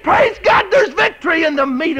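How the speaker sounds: shouted, high-pitched preaching climax; preaching at full force. Acted. Preacher shouting to the congregation, fervent full-force preaching. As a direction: cry it out to the congregation.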